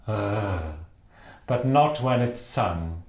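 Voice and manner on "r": "R" as a uvular rasp; throaty, held "R"; spoken French R. Shown as a demonstration. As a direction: gargle "R" in the French way. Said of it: "It is pronounced R".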